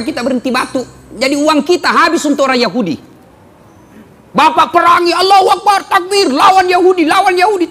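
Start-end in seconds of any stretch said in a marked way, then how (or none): 4.3-7.7 loud, high rallying shout; a louder, higher preacher's war cry. Acted. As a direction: whip up the crowd with a loud cry.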